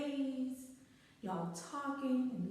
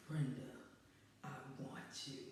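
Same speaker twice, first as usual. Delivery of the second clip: much lower and much softer, a hushed, breathy-sounding line; low seductive come-on; 8 semitones lower, 11 dB quieter than usual. Retold or imitated. The guy leaning in to tell his date, low hushed come-on. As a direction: purr it low like a smooth-talking date. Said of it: "if he said it like that".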